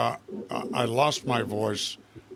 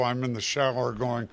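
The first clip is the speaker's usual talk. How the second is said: ordinary talk, nothing marked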